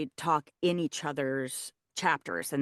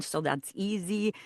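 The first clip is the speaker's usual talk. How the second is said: French accent; 2 semitones higher, 3 dB quieter than usual. Shown as a demonstration. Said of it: "there's a French accent".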